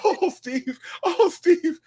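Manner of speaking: high, swooning mock co-host; Shirley Strawberry impression. Co-host gushing over the host, high mock swoon. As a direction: coo his name like an adoring fan. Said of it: "And Shirley be".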